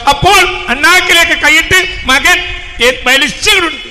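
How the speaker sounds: loud, impassioned sermon voice, slowing in places; full-force preacher's cry; fiery preaching. Acted. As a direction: preach it out to the whole mosque.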